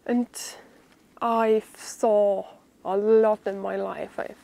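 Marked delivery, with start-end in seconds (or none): none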